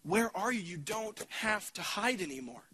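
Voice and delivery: tender calling. Acted. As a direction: call them home gently, like a longing father. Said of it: "out of the beckoning of God's heart".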